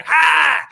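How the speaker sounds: high loud 'Ha!' yelp. Pretend. [name] hologram hype.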